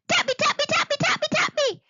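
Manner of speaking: high squeaky creature shouting; tiny shouting voices. Acted. Pink things surrounding Eddie again, high squeaky shouts. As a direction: shout it like a swarm of tiny gremlins. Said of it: "Dozens of tiny voices shouted".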